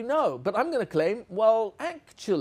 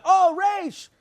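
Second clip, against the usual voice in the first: high, louder mock child's yell; kids screaming out; 11 semitones higher, 5 dB louder than usual. Kids spotting the letter in the game, mock scream. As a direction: yell it like a class spotting the answer. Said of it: "the kids will scream out".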